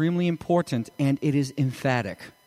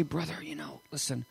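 hushed, much softer aside to a brother; gentle confiding; 14 dB quieter than usual. Acted. Believer gently approaching a brother about sin, hushed. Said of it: "go to your brother and you say".

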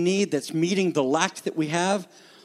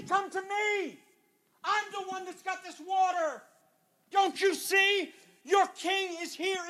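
Jesus calling out; 11 semitones higher, 9 dB quieter than usual. Acted. Jesus voiced crying out invitation.

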